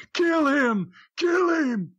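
very high, strained mock yell; two high cries imitating a fan screaming; retold scream. Friend screaming at Dale all the way down there, retold scream. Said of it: "he's screaming at Dale".

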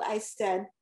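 ordinary delivery

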